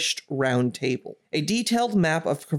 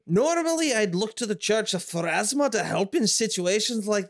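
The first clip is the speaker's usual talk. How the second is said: put-on Scottish accent; 3 semitones higher than usual. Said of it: "Queen Cressida has a Scottish accent".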